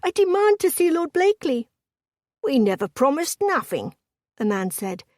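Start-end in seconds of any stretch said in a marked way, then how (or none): 0.0-1.7 high, loud panicked woman's demand; loud, high, frightened insistence. A narrator's reading. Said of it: "her voice sounded panicked". 2.4-4.0 higher-pitched Cockney man's voice; broad Cockney retort; thick Cockney accent. A narrator's reading. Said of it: "thick with a Cockney accent".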